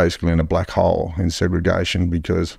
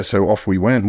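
normal talking voice

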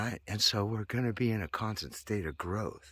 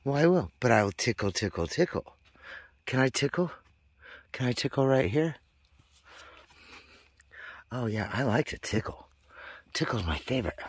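playful pet talk; 4 semitones higher, 3 dB louder than usual. Real, not acted.

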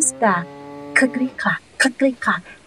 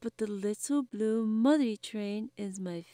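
soft judge; 2 semitones lower, 14 dB quieter than usual. A narrator's reading. Judge announces favorite.

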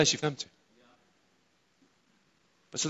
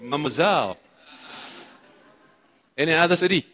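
ordinary talk, nothing marked

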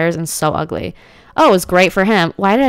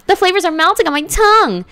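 very high, hammed-up rapture; mock gushing over food; 11 semitones higher, 4 dB louder than usual. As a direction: pitch it way up in playful delight. Mock gushing, corrected take.

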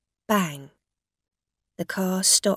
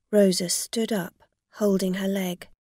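ordinary delivery

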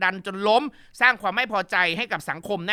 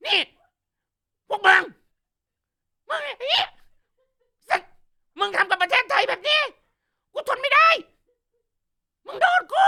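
imitated shout building far higher and louder; screaming tirade; 10 semitones higher, 4 dB louder than usual. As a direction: rage at the enemy, rising to a scream. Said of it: "เพราะคุณแม่แบบ". (เลียนเสียงตะโกนด่า สูงและดังขึ้นเรื่อยๆ จนเป็นเสียงกรีด)

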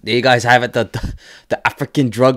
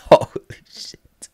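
awed whisper; slower than usual. Real, not acted. Stunned reaction to game video.

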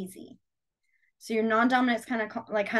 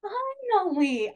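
relieved; 9 semitones higher, much slower than usual. Retold or imitated. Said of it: "I was like".

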